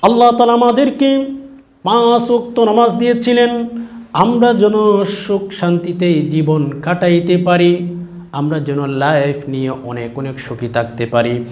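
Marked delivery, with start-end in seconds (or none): 0.0-7.9 high, loud sermon delivery, easing down by the end; impassioned preaching. Real, not acted. Preacher tells the faithful why prayer was given, impassioned preaching. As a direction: lift the voice to preach to a crowd. Preacher lifts into full-force exhortation.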